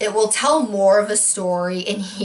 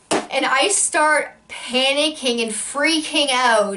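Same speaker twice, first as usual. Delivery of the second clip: ordinary delivery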